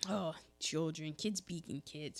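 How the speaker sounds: a kind neighbour shrugging it off; indulgent. Retold or imitated. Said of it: "he was like".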